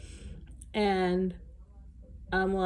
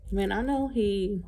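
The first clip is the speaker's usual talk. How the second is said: ordinary delivery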